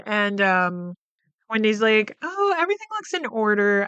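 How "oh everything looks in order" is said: mock breezy Wendy. Wendy retold checking the house.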